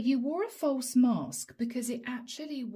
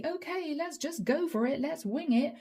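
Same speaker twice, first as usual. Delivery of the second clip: higher, carefree mock impulsiveness; mock crazy energy; 5 semitones higher than usual. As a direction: toss it off in a high, reckless sing-song. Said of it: "No erratic energy, no crazy".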